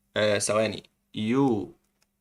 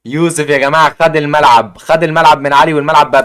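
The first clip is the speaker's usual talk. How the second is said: excited shout; 8 dB louder than usual. Real, not acted. Streamer hyping the winner to chat, excited shout. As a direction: yell it out like a stadium announcer.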